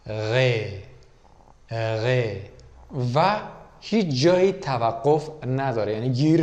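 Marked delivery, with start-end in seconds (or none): 0.1-2.4 غ drawn out from the back of the throat like gargling; throaty continuous غ; gargled gh sound. Shown as a demonstration. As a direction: let غ flow from deep in the throat, no stop. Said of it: "مثل غرغره کردن آب توی گلو".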